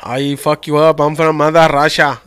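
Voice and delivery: mock-threatening Russian accent. Trying to outdo his friend, threatening Russian accent. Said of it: "Give me a Russian accent".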